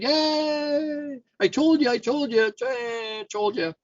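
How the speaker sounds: high-pitched crowing donkey; hammed-up squeal of triumph, last words drawn out; gleeful gloating. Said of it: "the donkey gets all excited".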